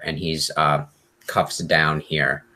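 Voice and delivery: ordinary talk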